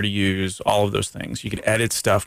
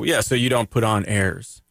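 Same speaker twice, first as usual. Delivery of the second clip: usual speaking voice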